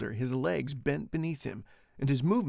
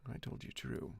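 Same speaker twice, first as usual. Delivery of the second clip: cold; 11 dB quieter than usual. Shown as a demonstration. Reader rehearsing the Count's reply, cold and hushed. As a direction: try the line cold and hushed.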